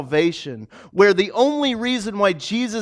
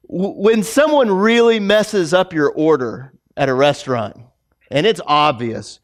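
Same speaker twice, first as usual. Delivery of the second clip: usual speaking voice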